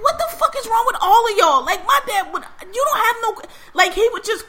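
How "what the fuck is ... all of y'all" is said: high, loud incredulous cry; exasperated outburst. Retold or imitated. Viewer reacting to the whole family, exasperated. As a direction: retell your disbelief at full pitch.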